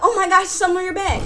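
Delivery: put-on high, fast kid's taunt; mock excited prank call. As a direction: blurt it out high and quick. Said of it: "She stand back up".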